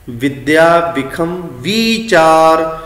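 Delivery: slow line with a long ee in ਵੀਚਾਰ; correct recitation. Shown as a demonstration. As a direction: model the right reading slowly. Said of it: "ਇਸ ਤਰ੍ਹਾਂ ਅਸੀਂ ਪਾਠ ਕਰਾਂਗੇ". (ਹੌਲੀ ਸਹੀ ਉਚਾਰਨ ਵਾਲਾ ਪਾਠ)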